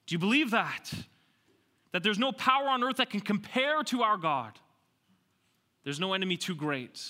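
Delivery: full-force preaching. Real, not acted. Preacher urging the congregation to trust God, full-force preaching. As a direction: press the congregation to believe it.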